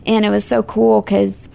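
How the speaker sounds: normal talking voice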